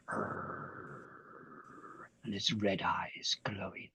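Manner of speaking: hushed growling whisper. A narrator's reading. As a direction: whisper it like a scary story. Wolf growl, suspense.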